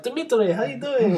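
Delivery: high, loud jokey greeting; high mocking greeting. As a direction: greet him in a silly high voice. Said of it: "And he's like".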